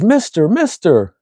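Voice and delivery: put-on high, drawn-out little kid voice; young girl. Young girl calling to her program host, high kid voice. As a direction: call out slowly like a concerned child. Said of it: "one of the young kids in the program".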